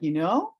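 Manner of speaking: "You know" lifting into a question; rising inflection. Shown as a demonstration. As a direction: let "know" climb as if asking. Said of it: "you have a rising inflection. So it sounds like this".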